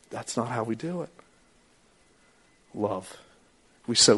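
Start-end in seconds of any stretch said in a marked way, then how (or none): none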